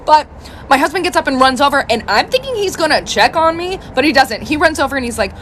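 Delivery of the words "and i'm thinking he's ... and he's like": ordinary delivery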